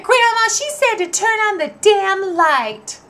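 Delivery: put-on high, slow innocent child voice; tattling kid. Boy repeating the bad word to grandma, high and innocent. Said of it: "And I said".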